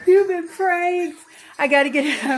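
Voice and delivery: loud high gleeful shout; prank reveal laughing. Real, not acted.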